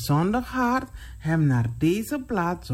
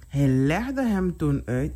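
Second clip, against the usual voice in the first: plain reading voice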